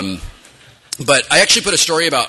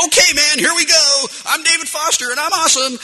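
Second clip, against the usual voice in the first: cocky soldier; 13 semitones higher than usual. Retold or imitated. Game character pumping himself up, high and cocky. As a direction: pump himself up like a game hero. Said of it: "Foster's like".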